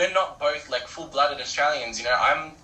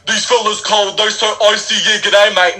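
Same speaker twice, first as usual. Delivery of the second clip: put-on loud, higher-pitched parody of an Aussie rapper's accent; 6 semitones higher, 7 dB louder than usual. Rapper mocking what people expect of Australian rappers, exaggerated Aussie accent. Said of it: "That's just a stereotype".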